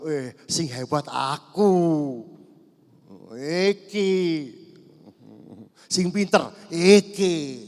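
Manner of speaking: mock-proud bragging, drawn out; hammed-up arrogant younger self; boastful self. Mocking his former proud self. (pamer sombong dibuat-buat)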